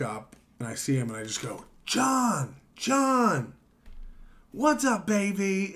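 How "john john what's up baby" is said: high, drawn-out call across a bar; boisterous mock greeting, pitched way up. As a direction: hail a buddy with a high, falling holler. Calling out to John in bar.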